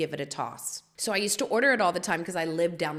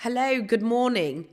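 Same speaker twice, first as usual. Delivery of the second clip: loud, raised, imitated worker's hello; worker's morning greeting; 6 semitones higher, 7 dB louder than usual. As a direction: call out a hearty good morning. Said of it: "every morning he'd be like".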